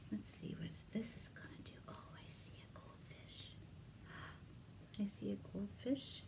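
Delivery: soft unvoiced whisper; hushed excited whisper. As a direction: breathe it like a secret. Whispered aside at pop-up.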